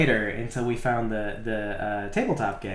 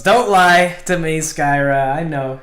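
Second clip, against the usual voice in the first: mock accusing; 5 semitones higher, 10 dB louder, slower than usual.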